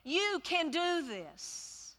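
a hint of Caleb's rallying call; Caleb encouraging. A narrator's reading. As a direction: urge them on gently as Caleb. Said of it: "And Caleb said".

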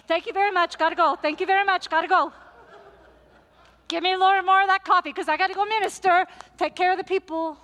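high, loud and fast; hurried loud brush-off. Retold or imitated. Pastor thanks Luisa while rushing off, loud and fast. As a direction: gulp and dash out the door. Pastor rushes off after soup.

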